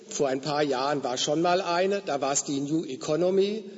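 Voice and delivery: usual speaking voice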